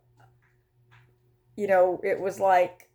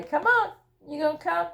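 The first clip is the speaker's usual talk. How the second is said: high sing-song pet call; cat-coaxing call, pitched way up; 11 semitones higher, faster than usual. Real, not acted. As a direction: coax the cat over in a much higher voice.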